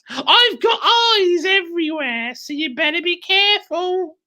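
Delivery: high, shouted crone warning; shrieking crone. Acted. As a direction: screech the threat like a hag. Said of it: "cackled Missus Twit".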